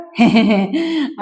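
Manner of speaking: short teasing chuckle in character; acted giggle. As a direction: giggle as if remembering something silly. (నటిస్తూ చిన్న నవ్వు)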